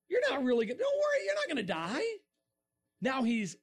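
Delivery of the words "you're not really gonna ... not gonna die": hammed-up dismissive serpent; coaxing tempter. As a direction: voice leaping high on a false reassurance. Acts serpent coaxing Eve.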